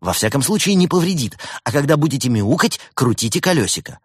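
bright-eyed lad answering the helpline; cheerful young technician. A narrator's reading. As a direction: play an upbeat young support worker. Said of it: "молодой и жизнерадостный".

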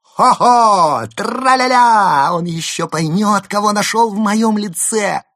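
very high, loud gleeful trill; gleeful singsong. Acted. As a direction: trill it out in triumph. Berta's diary, gleeful.

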